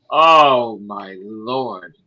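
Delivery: ordinary talk, nothing marked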